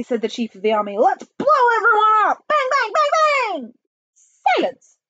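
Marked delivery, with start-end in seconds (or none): 1.0-3.7 very high, loud, frenzied shout; gleeful warmonger. Acted. Army chief exults. 4.1-5.0 high, loud command; piercing shout for order; barked order. Acted. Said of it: "barked Miss Tibbs".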